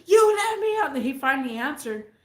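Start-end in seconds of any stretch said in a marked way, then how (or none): 0.0-1.0 high, loud mock yell of the girlfriend; retold yell. Girlfriend yelling at him over the phone, a high, loud mock yell. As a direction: shout it at him like she's been left behind. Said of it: "she just like".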